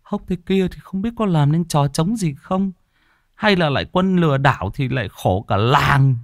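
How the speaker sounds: high, sneering village gossip; gossiping villager. Acted. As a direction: whisper a doubt behind their backs, pitched high. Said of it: "lại rỉ tai nhau". (giọng dân làng xì xào, cao và mỉa mai)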